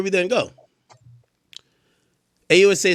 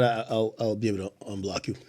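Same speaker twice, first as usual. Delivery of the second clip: low muttering aside; quiet mumble; 6 semitones lower, 9 dB quieter than usual. Real, not acted. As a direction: say it half to yourself.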